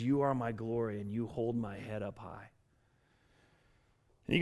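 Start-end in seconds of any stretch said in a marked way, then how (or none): 0.0-2.5 low, softened psalm reading; hushed quotation. Acted. Preacher voicing David's confession to God, low and subdued. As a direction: let it drop low and quiet, as if praying.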